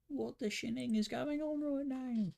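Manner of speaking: very high-pitched British caricature; mock British accent. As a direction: squeal it in exaggerated mock British. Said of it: "British people be like".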